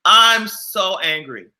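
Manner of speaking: mock angry. Real, not acted. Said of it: "I'm angry".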